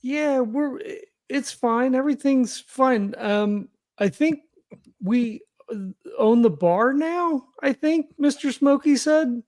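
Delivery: a son caught cooking, stammering excuses to mom; a lightly voiced son explaining himself too fast; nervous son. [name] evades mom's questions.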